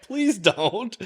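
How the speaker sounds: said high through laughter; real laugh, high and giggly; laughing plea. Real, not acted. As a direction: beg him while still laughing. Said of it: "I'm like".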